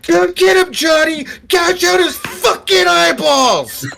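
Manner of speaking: high-pitched, bloodthirsty scream; screaming receptionist. Acted. Timid librarian receptionist on a chair urging Johnny on, screaming. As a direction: shriek the order like a timid woman suddenly unleashed. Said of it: "will actually get up on the chair and scream".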